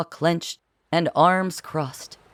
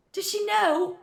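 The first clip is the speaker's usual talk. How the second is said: high screechy Philip, but quiet; a mild high screech of alarm; 4 dB quieter than usual. A narrator's reading. Philip screeching to Francois behind the door, high. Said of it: "she heard Philip screech".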